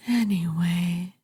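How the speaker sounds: normal talking voice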